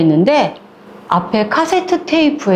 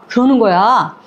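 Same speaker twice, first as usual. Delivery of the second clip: plain reading voice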